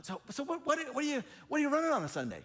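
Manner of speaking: high, eager probing voice; a pastor fishing for church size, high and eager; probing question. Retold or imitated. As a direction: fish for the number, high and keen. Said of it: "So they'll go".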